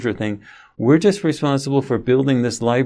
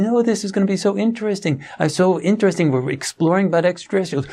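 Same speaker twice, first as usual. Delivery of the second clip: much higher, giddy self-imitation; mock eager self; 8 semitones higher, faster than usual. Father gushing about extraterrestrials to his son, mock excited and higher. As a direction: parody his own naive enthusiasm. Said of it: "And I was saying".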